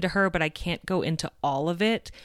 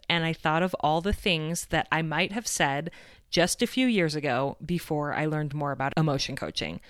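ordinary delivery

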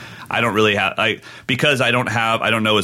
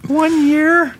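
put-on much higher and louder, a tiny boy's answer; little kid voice; 5 dB louder than usual. Eight-year-old answering the drummer, high child voice. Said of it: "in his little Harry Potter voice".

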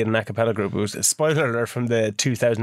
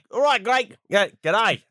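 high-pitched put-on Australian greeting; Australian accent; 6 semitones higher, slower than usual. Greeting Greg from Australia, in Australian accent. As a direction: greet him in a broad mock Australian accent. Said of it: "doing an Australian accent".

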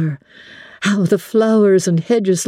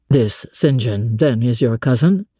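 a lower gentleman, asking pointedly; low male voice, probing; 8 semitones lower than usual. A narrator's reading. Master quizzing Jane about her cousin, low and probing. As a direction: voice the master, low, edging into jealousy.

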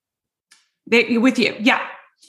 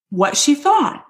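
normal talking voice

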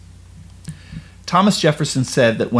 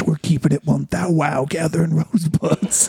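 ordinary talk

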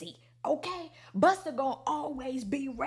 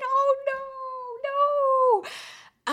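high loud "oh no" trailing to breath; dismayed high cry fading; 8 dB louder, much slower than usual. Retold or imitated. Fan watching Kim walk off, dismayed cry fading out. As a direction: gasp in dismay.

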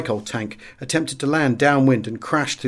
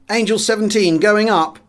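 higher, louder pilot's reply; radio reply; 8 semitones higher, 4 dB louder than usual. A narrator's reading. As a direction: report it clipped over the radio. Section leader replies by radio.

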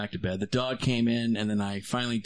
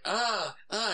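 mock panicked ah; 8 semitones higher than usual. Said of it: "I was like".

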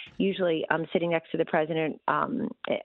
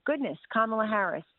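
ordinary talk, nothing marked

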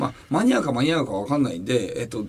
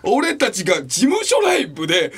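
ordinary talk, nothing marked

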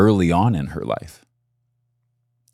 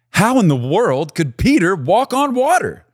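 wondering question; 13 semitones higher, 3 dB louder than usual. Acted. Preacher voicing the question.

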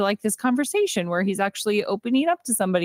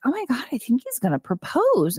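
higher-pitched imitation of eager excitement; excited retelling; 5 semitones higher than usual. Woman tells her friend about an expected proposal, mock-excited. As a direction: gush it lightly, as a retold confidence.

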